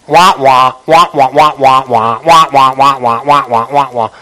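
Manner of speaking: low, monotone "wah-wah" babble; imitated droning chatter. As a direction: mimic endless meaningless talk in a low drone. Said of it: "this is how it sounds".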